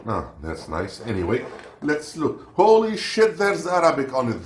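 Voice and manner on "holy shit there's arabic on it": much higher and louder burst of shock; real shock at finding Arabic on the packet; shocked exclamation. Real, not acted. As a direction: blurt it out, genuinely startled. Shocked on spotting Arabic label.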